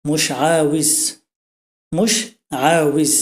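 মুশআউইজ stretched out very slowly, syllable by syllable; teacher models colloquial মুশআউইজ very slowly; very slow Arabic demo. Shown as a demonstration. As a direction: drag মুশআউইজ out for learners. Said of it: "আর এটা গ্রাম্য আরবি হচ্ছে".

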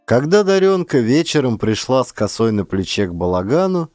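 ordinary delivery, nothing marked

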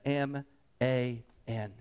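"M A N" one letter at a time; retold deliberate spelling of "M A N"; spelled out. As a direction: spell "M A N" with gaps between letters. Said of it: "he spelled it out".

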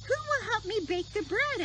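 high hen voice asking. Acted. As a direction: squeak it out as the hen. Hen asks in character.

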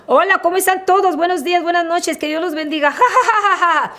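chirpy host voice hiding a depression; fake cheerful stage greeting. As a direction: put on the bright stage face and fake it. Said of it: "mi cara veintiséis".

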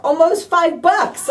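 ordinary delivery

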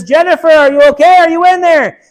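high, loud call toward a burning window. Acted. Father calling up to his daughter in the burning house, loud. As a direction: yell to be heard through the fire.

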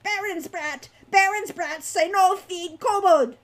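loud, high creature tirade; shrieking kobold. A narrator's reading. Captive kobold blaming the baron's son, shrieking. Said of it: "shrieked their captive".

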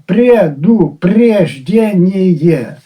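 Предупреждение stretched out syllable by syllable; a slow, dissecting Предупреждение; slow, drawn out. Shown as a demonstration. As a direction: drag out Предупреждение to show its doubled parts. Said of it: "Двічі тавтологія виникла".

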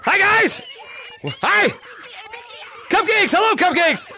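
high, bright-eyed hailing; excited greeting. Real, not acted. Streamer greeting players coming into the lobby, excited high calls. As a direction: call out high to arriving friends.

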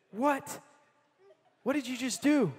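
startled disbelief. Retold or imitated. Butcher to the man who just prayed for his knee, stunned. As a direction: retell his stunned reaction. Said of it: "If people freak out".

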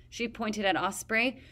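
plain reading voice

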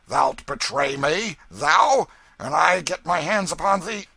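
loud, outraged outburst; furious threat. A narrator's reading. Hobbs threatens the king in a quarrel.

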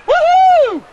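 high, loud whoop of triumph; loud cheer. Real, not acted. Storyteller celebrating his own punchline, loud cheer.

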